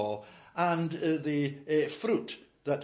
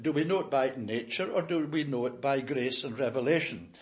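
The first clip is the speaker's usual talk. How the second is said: normal talking voice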